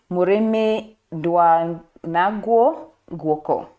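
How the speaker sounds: "Mureme dwa nagwo guoko" spoken very slowly, each word picked out with care; hesitant Kikuyu pronunciation, very slow; careful Kikuyu proverb. Shown as a demonstration. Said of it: "I'm going to butcher this".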